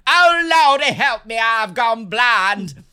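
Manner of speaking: loud, high, drawn-out Southern wail; yelled southern accent. Acted. Fast food manager joking to co-workers, yelled Southern accent. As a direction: holler it in hammed-up Southern. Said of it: "put on my best southern accent and yelled".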